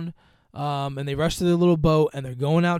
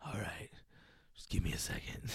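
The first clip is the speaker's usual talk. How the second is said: whispered; 8 semitones lower, 15 dB quieter than usual. Retold or imitated. He tries to calm himself.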